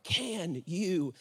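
low, soft plea; humble prayer. Acted. Believer comes before God's throne in need, humbly praying. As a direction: bring it right down into intimate prayer. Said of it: "coming back in a posture of prayer".

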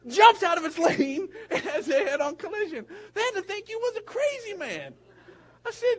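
high and loud, laughing through the words; laughing retelling. Retold or imitated. Pastor laughing to his friend about the crash.

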